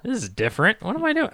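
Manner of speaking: lightly voiced puzzled player; playful baffled musing, high; mock confused. GM teasing player as a confused hero.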